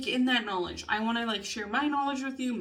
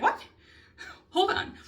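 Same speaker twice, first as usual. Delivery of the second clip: higher, incredulous what, hold on; a jolt of mock shock; retold disbelief; 10 semitones higher than usual. Recalling being told she can't be bisexual, incredulous.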